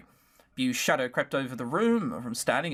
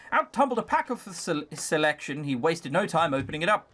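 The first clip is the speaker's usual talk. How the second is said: usual speaking voice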